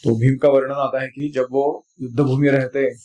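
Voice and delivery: normal talking voice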